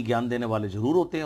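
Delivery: ordinary talk, nothing marked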